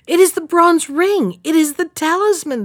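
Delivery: high, loud joyful shout; joyful cry. Acted. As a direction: burst out with joy. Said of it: "they cried joyfully".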